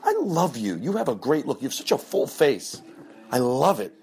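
high gushing hostess voice; over-sweet compliments. Retold or imitated. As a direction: flatter them to stop them eating. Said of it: "you go".

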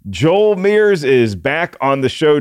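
normal talking voice

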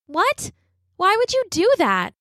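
put-on high, startled girl's voice; alarmed little sister; shocked. A narrator's reading. Girl shocked by brother's plan.

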